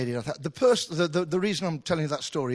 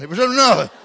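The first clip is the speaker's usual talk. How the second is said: mock rock star; 7 semitones higher, 12 dB louder, faster than usual. Joking reveal in [name] voice.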